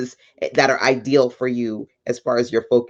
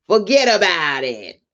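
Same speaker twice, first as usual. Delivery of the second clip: hammed-up Italian accent, pitched up; bad Italian accent; 4 semitones higher than usual. Host riffing on the word forget, bad Italian accent. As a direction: do a corny mock-Italian accent. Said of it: "It's, like, the worst Italian accent".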